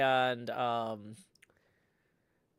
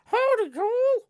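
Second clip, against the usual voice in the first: high ventriloquist puppet voice; 10 semitones higher, 3 dB louder, slower than usual. Acted. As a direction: make the puppet talk, high and excitable. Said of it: "I got my puppet pals here".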